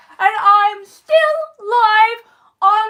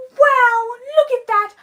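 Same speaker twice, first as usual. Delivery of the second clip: ordinary delivery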